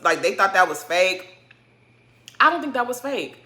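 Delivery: normal talking voice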